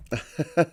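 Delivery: brief amused laugh; short chuckle. Real, not acted. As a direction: chuckle at your own words. Amused at own phrase.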